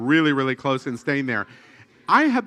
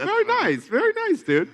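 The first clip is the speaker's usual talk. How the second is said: way up high, gushing. Acted. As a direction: gush over it in a squeaky high voice. Gushing over the hotel.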